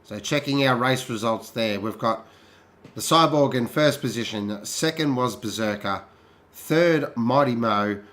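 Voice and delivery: calm, slow results list; results read-out. Real, not acted. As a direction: read the standings evenly.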